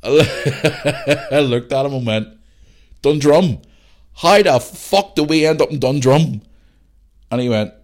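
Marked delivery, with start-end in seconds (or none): 3.0-6.4 loud, high disbelieving outburst; incredulous. Retold or imitated. Lost lad to his mate, incredulous. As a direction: demand an answer in loud amazement.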